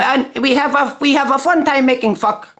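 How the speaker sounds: high, put-on Arabic accent; hammed-up mock Arabic accent. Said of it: "I'll give you a little one".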